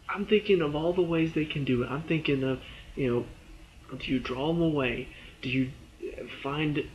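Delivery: ordinary talk, nothing marked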